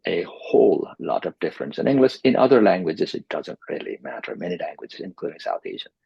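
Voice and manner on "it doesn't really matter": ordinary talk, nothing marked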